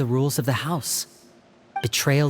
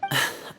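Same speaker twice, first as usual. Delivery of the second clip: weary sigh; 5 dB quieter than usual. Acted. Tired after failing.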